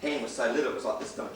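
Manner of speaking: normal talking voice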